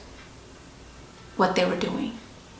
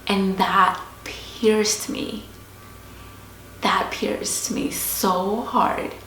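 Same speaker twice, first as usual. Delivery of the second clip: slow emphasis; much slower than usual. Real, not acted. Emphasising the scripture's impact.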